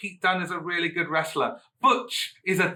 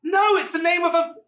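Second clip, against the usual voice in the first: excited gush; 13 semitones higher, 5 dB louder than usual. Real, not acted. Raving excitedly about Butch.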